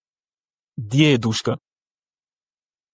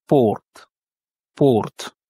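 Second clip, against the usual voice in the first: "porte" said alone as stressed Russian "PORT", t sounded; stressed last syllable. Shown as a demonstration. Teacher shows where the tonic accent falls, stressed final syllable. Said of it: "l'accent tonique est sur la dernière syllabe".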